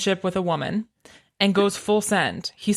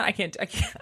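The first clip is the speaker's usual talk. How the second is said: usual speaking voice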